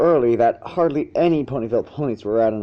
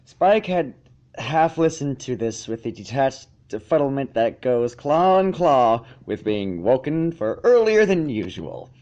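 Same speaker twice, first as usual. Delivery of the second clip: plain reading voice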